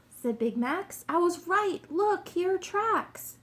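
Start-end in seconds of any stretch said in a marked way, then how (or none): 1.1-3.4 high, loud detective announcing a find; excited triumph. A narrator's reading. Detective shows the king the tracks, excited and triumphant. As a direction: crow over the discovery, pitched right up.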